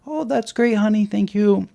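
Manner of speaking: high, sugary praise for the daughter; sweet parent voice. Retold or imitated. Parent praising daughter's piano playing, sugary high voice. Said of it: "try to defuse the situation".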